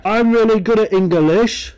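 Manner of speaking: high, loud smug classmate; mocking know-it-all. Streamer mocking the classmate who boasts about English, high and loud. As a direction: parrot the smug kid. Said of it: "There was always that one guy".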